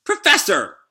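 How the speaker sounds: high, loud cry of Professor; indignant shout. Acted. Wise shouting at the traitor professor, high and loud. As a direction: yell it out in outrage.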